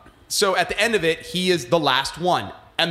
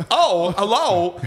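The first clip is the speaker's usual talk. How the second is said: slow, higher, oddly spaced Walken drawl; Christopher Walken; 7 semitones higher, slower than usual. Pretend. Slow odd Walken voice, mocking Obi-Wan for friends. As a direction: stretch each word like Walken. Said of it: "You don't wanna do Christopher Walken?".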